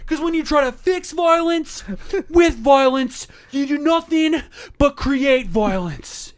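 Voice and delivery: loud, high, slow mock drama; dramatic [name]. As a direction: overact it like a rock singer. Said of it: "so dramatic with it".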